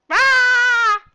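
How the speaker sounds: very high, very loud shriek of pain. Acted. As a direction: scream as the donkey kicks. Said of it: "shrieked Lefty".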